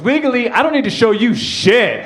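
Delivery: high, haughty comeback; indignant dog owner. Acted. Lady with dog refusing to show papers, indignant. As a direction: put her in her place, pitched high. Argument with Starbucks worker.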